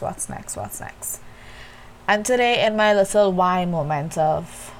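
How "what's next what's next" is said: fading whisper. Retold or imitated. Alone at night, thinking of what's next, fading to a whisper. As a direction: let the words die away to a whisper. Racing thoughts trail off.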